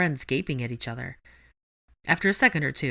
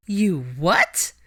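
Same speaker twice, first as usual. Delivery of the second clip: shocked; 3 semitones higher, 5 dB louder than usual. Acted. Ginny shocked.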